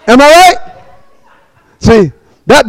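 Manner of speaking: ordinary talk, nothing marked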